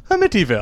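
"Amityville" as uh-MIT-ee-ville, as if just remembered; recalled mispronunciation. Retold or imitated. Friend remembering the town's name, "Amityville" said uh-MIT-ee-ville. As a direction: imitate "Amityville" with stress on MIT. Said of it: "like she's trying to remember how to say it".

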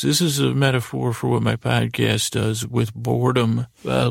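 usual speaking voice

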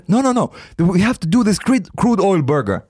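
high-pitched, overriding imitation; eager insistence. Imitating a friend insisting on the burger, excitedly adamant. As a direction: brush off objections with mock urgency.

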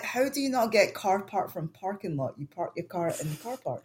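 high, exasperated protest; incredulous retort. Retold or imitated. Scot retorting to Americans over car park, incredulous. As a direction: argue the obvious in disbelief.

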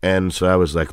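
ordinary talk

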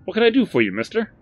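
hearty shopkeeper greeting; Barry's eager voice. Acted. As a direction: chirp it like a keen salesman. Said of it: "said Barry".

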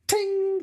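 tiny bright bell sound, very high; ping it like a typewriter bell; high typewriter ting. Retold or imitated. Imitating the typewriter bell on Nine to Five, high ping.

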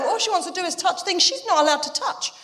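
ordinary delivery

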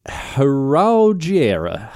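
very slow "Heraugière" sounded out as "heh-roh-zhee-AIR"; careful French pronunciation. Shown as a demonstration. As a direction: drag "Heraugière" out syllable by syllable, French style. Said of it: "I don't know how to say it".

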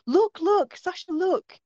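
put-on very high, insistent kid's cry; urgent child. Four-year-old shaking her sister's shoulder, urgent. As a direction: imitate a little sister demanding attention.

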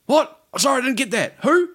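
high, baffled questioning; feigned incomprehension. Retold or imitated. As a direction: play at not catching a word. Acting out baiting the phone caller.

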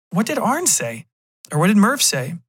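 high-voiced girlfriend, concerned question. Girlfriend asks about his boss, incredulous. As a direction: pitch up into her insistent questioning.

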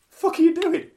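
high, loud exasperated question; mock incredulous outburst. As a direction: imitate a baffled official exclaiming. Acted commissioner's incredulous outburst.

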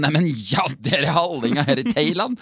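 hammed-up thrill at meeting your own people; delighted greeting. Hallingdal native greeting fellow hallings abroad, mock excited. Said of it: "Da er det sånn".

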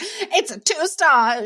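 high, drawn-out joke announcement; mock verdict. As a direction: stretch out the silly verdict, high. Acting out a contradictory verdict.

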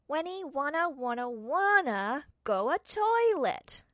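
put-on high, loud drawn-out child's wail; shrieking toddler. Little Wentworth shrieking at his sister about the toilet. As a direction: wail it like a small boy who can't wait. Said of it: "Wentworth shrieked".

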